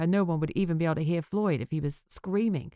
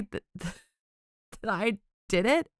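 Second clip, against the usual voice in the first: slow, disbelieving laugh mid-line; incredulous laugh; 4 semitones higher, much slower than usual. Acted. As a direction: laugh at the absurd accusation.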